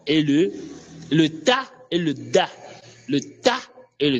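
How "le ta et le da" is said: ordinary delivery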